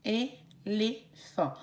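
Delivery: slow é-lé-phant, each syllable apart. Shown as a demonstration. Teacher to kids, repeating the syllables. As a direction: beat it out in three. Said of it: "trois syllabes".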